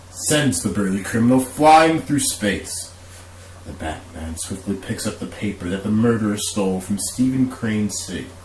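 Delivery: ordinary talk, nothing marked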